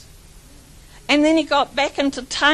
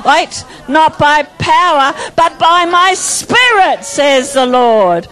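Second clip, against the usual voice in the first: slower fervent declaration; 2 semitones higher, 5 dB louder, slower than usual. Shown as a demonstration. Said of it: "Come on, get into it".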